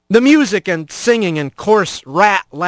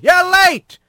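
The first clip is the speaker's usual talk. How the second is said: high-pitched yell across the docks; yelled reproach; 12 semitones higher than usual. A narrator's reading. Dock foreman to a late new worker, yelling. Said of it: "waved at him and yelled".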